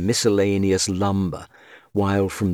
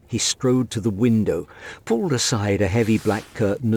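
ordinary delivery

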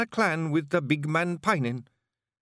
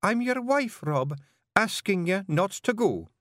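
high, slow, mild plea; pleading wife; 12 semitones higher, 3 dB louder, slower than usual. Acted. Jeanie asks Rob as his wife to stay, in a mild plea. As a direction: ask him gently.

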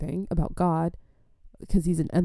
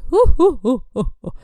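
high, loud "hoo-hoo" whoops; whooping worshippers; 8 semitones higher, 6 dB louder than usual. Retold or imitated. Imitating kooky old ladies whooping in service, high hoots. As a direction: mimic sudden church whooping. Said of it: "they would just be in service sometimes and be like".

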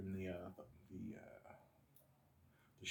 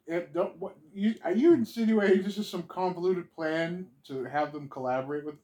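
mock outrage; 7 semitones higher, 11 dB louder than usual.

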